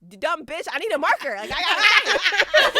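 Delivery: mock exasperation. Idol fed up with the guard, mock exasperated. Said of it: "looking at him like".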